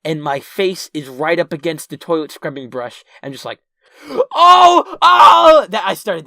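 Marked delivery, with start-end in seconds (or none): 4.3-5.7 high, very loud panicked wail; freaking out. Retold or imitated. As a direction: re-enact a full-blown panicked cry. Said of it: "that's when I started freaking out".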